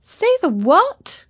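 high, slow baffled question; puzzled high question. Acted. Katy puzzled by French.

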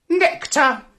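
high, tiny creature voice; little butterfly voice. Acted. Butterfly tells dog what to eat, high little voice.

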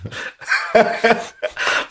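breathy high laugh breaking out; real burst of laughter. Real, not acted. Recalling the constant nagging.

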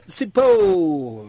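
ordinary talk, nothing marked

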